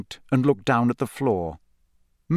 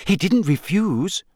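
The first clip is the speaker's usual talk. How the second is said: mildly shocked, higher-pitched gasp; 6 semitones higher than usual. A narrator's reading. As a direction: gasp it in disbelief, lightly. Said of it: "gasped Hermione".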